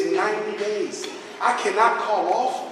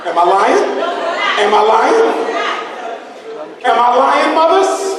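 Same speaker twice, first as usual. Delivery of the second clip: loud, rising call to the audience; rally the mothers in the crowd; loud rising challenge; loud rhetorical question; 3 semitones higher, 6 dB louder than usual. Real, not acted. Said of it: "asking audience".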